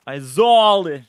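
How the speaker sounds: put-on broad Boston accent, drawn out; playful Boston accent; mock Boston accent. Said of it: "It sounds like Boston".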